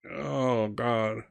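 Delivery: soft, deflated groan of words; quiet despair. Acted. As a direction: let it sink out softly, overwhelmed. Kenneth collapses against a wall.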